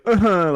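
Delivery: high whimpered fake sob; mock fake crying. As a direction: imitate a toddler's fake cry. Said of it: "like, fake crying".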